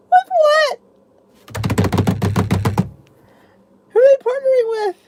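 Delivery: high, loud, eager asking; excited question. Real, not acted. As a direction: squeal for the answer. Eager question about the news.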